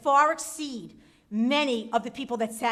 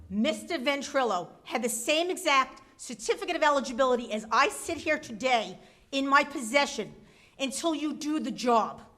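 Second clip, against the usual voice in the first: ordinary talk, nothing marked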